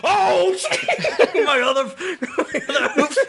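high, cracking up mid-sentence; losing it over a disastrous fumble; helpless laughing. Real, not acted. Laughing at a natural one roll.

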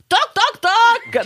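high, sharp mock knocks; imitated knocking. Neighbour knocking at the door, high mock knocking. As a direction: rap out the knocks high and punchy. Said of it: "comme ça".

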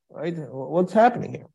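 ordinary talk, nothing marked

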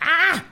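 short high yelp of pain; mock pained cry. Host voicing the poked sister's high yelp. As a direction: yelp like you've been poked. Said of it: "And was like".

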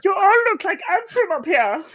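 high, loud mock German accent as Heimlich; hammed-up German accent, squeaked from on high. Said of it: "your Heimlich voice".

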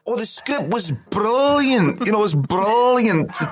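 put-on high, loud, drawn-out old luvvie reminiscing; Billy Connolly. Older self reminiscing in a documentary, mimicked Connolly voice. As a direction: gush slowly, pitched well up, as an elder star. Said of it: "this voice you used today".

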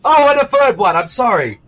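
British accent with hammy fake apology; British accent apologising for the broken windows; Malcolm McDowell British accent. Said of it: "my British accent".

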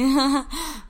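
loud high burst of laughter; bright laugh. Real, not acted. Friend laughing at the idea of being famous, loud laugh. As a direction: laugh out at the joke.

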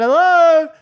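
high, loud re-enacted call; yelling out. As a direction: yell for someone down the corridor. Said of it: "I'm yelling out".

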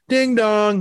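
sung high and bright like a doorbell; two-note doorbell ding dong; doorbell chime. Retold or imitated. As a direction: chime it out like the bell at the door. Imitating the DoorDash doorbell.

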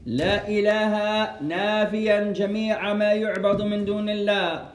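ordinary delivery

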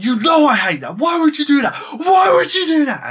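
hammed-up furious shouting, rising high; mega pissed. Man explodes at woman who took his photo, mock fury. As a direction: build from angry to screaming pitch.

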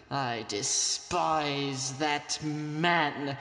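drawn out very slowly; slow contempt. Acted. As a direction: spit it out slowly with disdain. Drunk speaker slows in contempt.

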